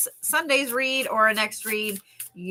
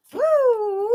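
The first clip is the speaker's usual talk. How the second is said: excited whoo; 14 semitones higher, 5 dB louder than usual. Real, not acted. Excited reaction to card.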